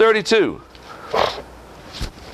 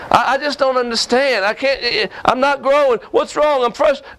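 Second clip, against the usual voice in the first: whiny frustrated believer; 7 semitones higher than usual. Retold or imitated. Preacher mimicking a frustrated churchgoer, whiny and higher.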